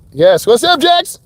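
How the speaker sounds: happy shout-out with a high jump on Jax; excited greeting. Real, not acted. Instructor greets viewer Jax on stream, excited. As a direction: voice leaps way up on the name.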